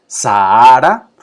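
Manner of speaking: Sahara drawn out slowly and loud, each a apart; stretched demo. Shown as a demonstration. Teacher showing the class the hiatus in Sahara, loud and slow. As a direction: sound out Sahara loudly in slow separate vowels. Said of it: "fíjense que aquí esta H no suena".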